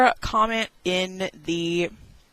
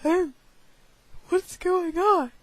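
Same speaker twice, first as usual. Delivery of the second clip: scared squeak; 10 semitones higher than usual. Retold or imitated. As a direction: bleat it out like a terrified kid. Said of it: "And I'm like".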